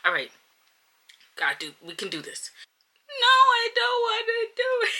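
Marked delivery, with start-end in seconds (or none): 3.1-4.9 high, loud sobbing no; tearful high refusal; crying protest. Real, not acted. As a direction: wail the refusal. Replay, crying shout.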